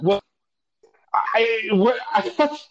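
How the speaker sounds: high, stammering disbelief; flustered. Retold or imitated. Patient asked about his breath yet again, flustered. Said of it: "getting a little more flustered".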